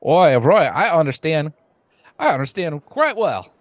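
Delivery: Southern-Cockney mix. Acted. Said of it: "like a Southerner with Cockney vocabulary".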